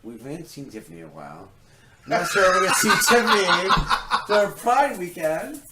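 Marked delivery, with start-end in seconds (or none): 2.1-5.6 chuckling through the name; laughing. Real, not acted. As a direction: laugh mid-sentence. Joking about Tiffany.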